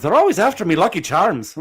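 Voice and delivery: lightly voiced Irish cereal mascot accent. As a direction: ham it up like the Lucky Charms ad in Irish. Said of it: "like Irish accents".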